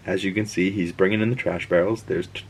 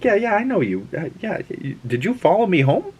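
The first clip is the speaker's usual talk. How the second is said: much higher, puzzled voice; wary and baffled, higher; confused stranger; 11 semitones higher than usual. Retold or imitated. Mobius meeting a stranger at home, much higher puzzled. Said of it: "and Mobius is like".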